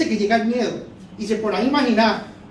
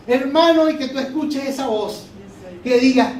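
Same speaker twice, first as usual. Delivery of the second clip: ordinary talk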